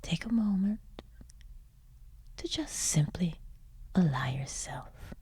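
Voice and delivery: soft, unhurried meditation voice with long pauses; hushed, soothing relaxation coaching; guided meditation. Acted. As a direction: ease the listener gently towards sleep, letting phrase endings fade. Leads a bedtime guided meditation.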